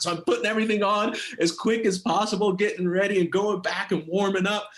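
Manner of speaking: ordinary talk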